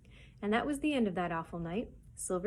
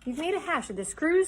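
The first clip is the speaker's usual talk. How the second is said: loud, raised mutineer's snarl; 8 semitones higher, 6 dB louder than usual. A narrator's reading. George Merry accusing his captain, snarling. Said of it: "snarled George Merry".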